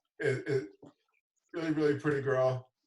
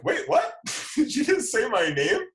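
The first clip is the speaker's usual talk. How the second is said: high, loud startled squeak; retold double take, laughing through it; shocked disbelief; 7 semitones higher, 5 dB louder than usual. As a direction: jump up in pitch, caught off guard. Shocked hearing his name read.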